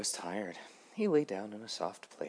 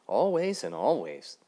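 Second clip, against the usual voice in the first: louder and slower, firm; proud declaration; 5 semitones higher, 8 dB louder, slower than usual. Acted. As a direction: say it proudly and slow. Grasshopper answers loudly.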